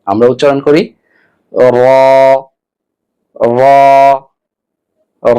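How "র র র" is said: "র" with tongue-tip edge against the palate; separate, sustained "র", tongue touching the palate; Arabic letter demonstration. Shown as a demonstration. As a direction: say each "র" as a held, rounded Arabic ra. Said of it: "উপরে তালুর সঙ্গে লাগাতে হবে".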